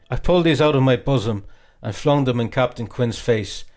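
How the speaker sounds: plain reading voice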